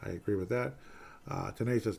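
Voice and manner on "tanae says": ordinary talk, nothing marked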